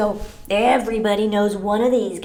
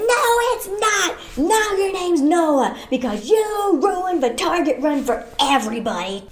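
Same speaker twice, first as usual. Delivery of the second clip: mock scold; 6 semitones higher than usual. Scolding the kid.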